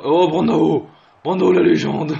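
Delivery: loud, excited cheer for the striker; bursting with excitement; excited shout. Real, not acted. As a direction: hype him up like live commentary. Excited play-by-play commentary.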